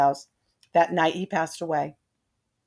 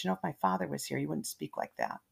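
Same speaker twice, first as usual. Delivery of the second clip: much softer, held low and quiet; lowered voice; 3 semitones lower, 11 dB quieter, faster than usual. Retold or imitated. Daughter scolding her husband in a hushed, much softer voice. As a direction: drop it down so the husband barely hears. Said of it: "Brittany lowers her voice".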